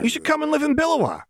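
very high, rapid chirp; pushy manic cheer; Jinx, insistent repeat. A narrator's reading. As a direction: fire it off brightly, brooking no refusal. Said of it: "exact same inflection, tone, cadence".

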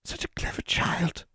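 soft, whispery sweet tone; kindly. A narrator's reading. Hag flattering the girl, mock kindly. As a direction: fake kindness. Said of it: "the hag said in a kindly voice".

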